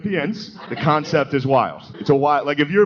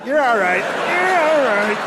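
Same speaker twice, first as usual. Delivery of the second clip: put-on coaxing toddler voice; 11 semitones higher, slower than usual. Guy comforting his girlfriend as if she were a toddler, high sing-song coo. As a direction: coo at her like a fallen toddler. Soothing partner like fallen toddler.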